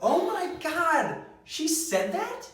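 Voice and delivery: high, slow gasp of disbelief; drawn-out shocked reaction. Acted.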